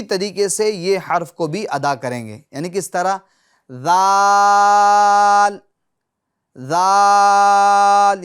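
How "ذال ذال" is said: ذال with the tongue tip at the upper teeth, loud and long; drawn-out recited zaal. Shown as a demonstration. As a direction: stretch ذال slowly with the tongue between the teeth. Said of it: "زبان کا سیرا اوپر کے دانتوں کا کنارہ". (ذال کو زبان کا سرا دانتوں سے لگا کر لمبا پڑھیں)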